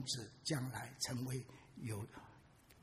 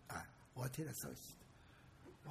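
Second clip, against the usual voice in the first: hushed aside; 4 semitones lower, 16 dB quieter, slower than usual. Real, not acted. Hushed aside after the quote.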